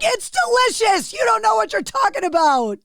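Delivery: high-pitched indignant protest; hammed-up offended insistence; mock outrage. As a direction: play up the exasperated parent, voice shooting up. Mock quarrel with fussy kids.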